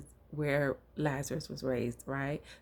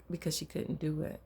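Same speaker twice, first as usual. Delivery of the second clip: normal talking voice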